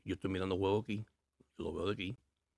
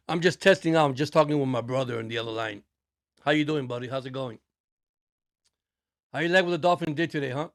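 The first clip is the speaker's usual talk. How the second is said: loud; 6 semitones higher, 10 dB louder than usual. Real, not acted. Calling brother on phone line.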